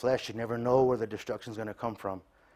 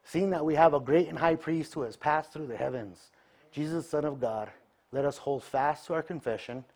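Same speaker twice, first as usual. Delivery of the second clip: scripture reading; 5 semitones higher than usual. Acted.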